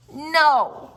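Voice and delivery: flat refusal. A narrator's reading. Lucille sulkily refusing her mother's offer.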